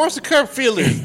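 mock warning shout. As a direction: yell a warning at the driver. Calling out to the driver.

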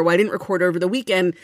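ordinary talk

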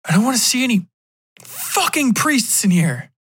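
high-pitched, seething refusal; angry outburst. Acted. Man rejects priests as girlfriend grows scared, angry.

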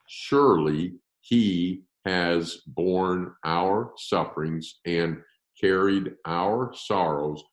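slow, deliberate reading of scripture; unhurried word-by-word read with long pauses; read slowly. Shown as a demonstration. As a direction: spell out each phrase so they can follow along. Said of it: "I'm gonna read it slowly".

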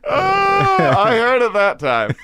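laughing hard while talking; words through a fit of laughter. Real, not acted. Laughing at own accent slip.

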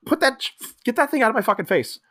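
mock annoyance. Host as Fink telling off Popo, mock annoyed. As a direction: act out an irritated, fast outburst. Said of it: "Fink just is just like".

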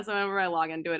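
usual speaking voice

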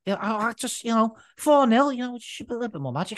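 a hint of a shrugging Gerrard; Stevie G impression. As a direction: lightly imitate a deflated manager. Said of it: "Stevie G said".

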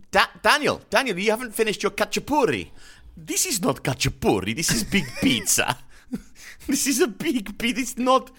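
high hammed-up Italian mother accent, fast; mock Italian mother fussing over food; Italian mamma. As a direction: scold Daniel like an Italian mamma. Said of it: "gone full Italian".

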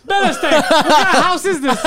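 high, loud shout through laughter; loud riffing. Acted. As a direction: holler the catchphrase over everyone, cracking up. Keeps riffing the catchphrase, laughing.

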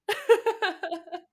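high, bright burst of laughter; real laugh. Real, not acted. Medium laughing at her own story of holding back tears. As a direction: laugh at yourself after the story.

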